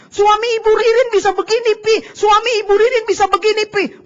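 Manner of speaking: high, loud hammed-up ad line; sing-song it like the TV commercial; mock TV ad delivery; ad jingle voice. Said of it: "Itu ada iklannya".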